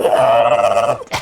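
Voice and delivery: loud wild wordless whoop; off-the-wall yell. Shown as a demonstration. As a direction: burst out in a ridiculous shout. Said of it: "ridiculous and off the wall".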